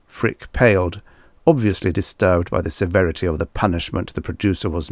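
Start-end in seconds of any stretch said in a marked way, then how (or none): none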